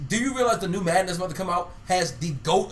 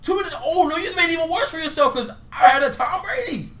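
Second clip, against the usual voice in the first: outraged shout; 9 semitones higher than usual. Real, not acted. Friend outraged at take.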